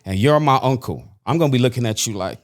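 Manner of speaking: usual speaking voice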